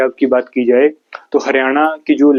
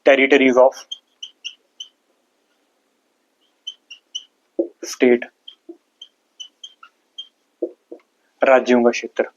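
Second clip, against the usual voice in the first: broken into short phrases with long pauses; dictation. Real, not acted. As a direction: dictate piece by piece for students writing. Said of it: "लिखो हिंदी वाले". (रुक-रुक कर notes लिखवाते हुए)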